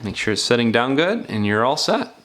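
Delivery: ordinary talk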